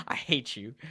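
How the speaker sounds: low, soft words through real laughter; laughing. Real, not acted. Streamer teasing Jaden who made him laugh, laughing. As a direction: say it while cracking up, quietly.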